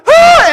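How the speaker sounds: high, loud scream of panic; panicked scream. Retold or imitated. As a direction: cry out in sudden terror at the current. Realizing he is pulled out.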